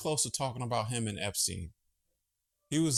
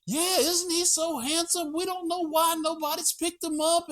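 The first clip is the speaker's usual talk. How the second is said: gushing shelter worker; 4 dB louder than usual. Retold or imitated. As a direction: coo over the handsome cat like shelter staff. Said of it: "shelter staff voiced in story".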